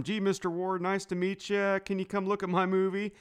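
light mock of a timid young director; timid fan-like George. Timid George inviting Roger to see his movie, mock polite. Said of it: "it goes from".

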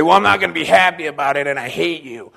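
mock grudging complaint; a hint of foot-dragging protest; grudging son. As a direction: lightly act out a reluctant, resentful son. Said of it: "do it grudgingly and drag his feet".

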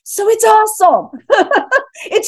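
excited laugh. Real, not acted. Guest gushing to the host, high excited laugh. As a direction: squeal it out with delight.

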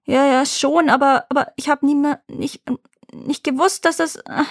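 high, halting stutter in fear; frightened stammer. Acted. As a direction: stammer it out, rattled and scared. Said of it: "Er stotterte verstört".

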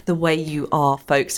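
usual speaking voice

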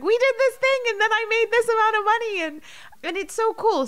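high and loud, thrilled; lit up. Retold or imitated. Mom telling her daughter about her course, high and thrilled. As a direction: voice the mom's bubbling excitement. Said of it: "how lit up she was".

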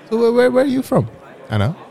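high, eager voice; eager self. Acted. Speaker playing himself meeting Anna, high eager voice. Acted dialogue, [name] as eager student.

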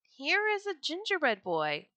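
calling to others. A narrator's reading. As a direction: call your workmates over to see. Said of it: "They called to one another".

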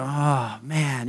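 soft, deflated sigh; discouraged. Retold or imitated. A mild, deflated sigh. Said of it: "kinda get down".

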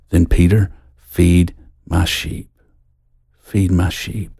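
Jesus entrusting Peter with his work, hushed and tender; tender command. Retold or imitated. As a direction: hand him the charge gently, dropping your voice. Jesus gently commissions Peter.

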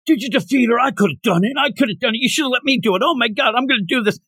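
high, frantic hothead voice, racing at the end; jealous boy ranting that he should have won; going nuts. Retold or imitated. Inosuke ranting at Tanjiro, high frantic excitement. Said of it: "He's going nuts".

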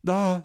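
sharp high startled shout of fear; a sudden loud gasp-cry at a face appearing; frightened cry. A narrator's reading. Néfi, startled by a face in the circle, cries out loud and high. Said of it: "s'écria Néfi, effrayée et surprise".

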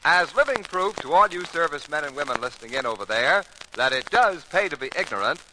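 usual speaking voice

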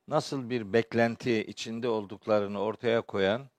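ordinary talk, nothing marked

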